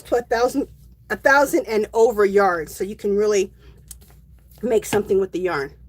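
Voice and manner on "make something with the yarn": ordinary talk, nothing marked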